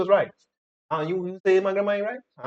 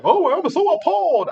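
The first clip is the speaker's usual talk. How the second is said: high, slow, sarcastic fake shock; mock appalled; 10 semitones higher than usual. Mocking the woman's pretend shock, sarcastic and drawn out. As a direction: sneer at her pretend innocence.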